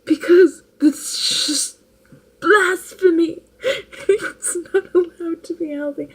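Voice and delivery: mock outrage breaking into laughter; mock dramatic. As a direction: drag out blasphemy like a scandal. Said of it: "And he's like".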